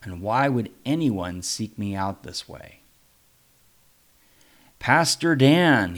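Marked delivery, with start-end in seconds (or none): 4.8-6.0 loud, slow, firm calling of a name. A narrator's reading. Luther firmly calls the pastor by name. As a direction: plant each word firmly, slow and loud. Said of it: "he said again firmly".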